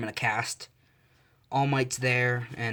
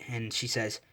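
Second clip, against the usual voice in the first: plain reading voice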